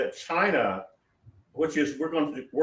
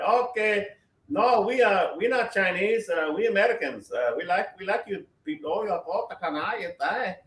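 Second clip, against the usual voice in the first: higher, put-on voice of a smooth foreign salesman; mock foreign salesman; 5 semitones higher than usual. China posing as Americans to win over buyers, higher mock-friendly voice. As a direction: pitch it up and fake being friendly Americans. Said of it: "they're not gonna talk to you and say".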